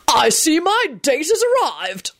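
high, slow gloating announcement; drawn-out, high-pitched crowing; triumphant. A narrator's reading. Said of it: "Richard shouted triumphantly".

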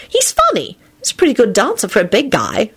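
put-on high, bright-eyed little girl. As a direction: chirp it like a young girl. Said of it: "Rosie commented".

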